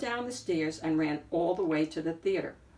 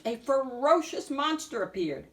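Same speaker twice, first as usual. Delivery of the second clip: ordinary delivery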